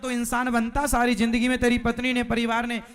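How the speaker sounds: loud, rebuking lecture building then easing; scolding doctor. Acted. Doctor scolding the drunkard for his wasted life, loud and rebuking. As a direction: tell him off like a fool who finally learned.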